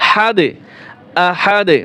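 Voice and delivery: হাদে and আহাদে with the final d bounced; qalqalah stop. Shown as a demonstration. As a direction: end হাদে and আহাদে on a popped d. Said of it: "কলকলা হবে". (হাদে, আহাদে: দালে কলকলা)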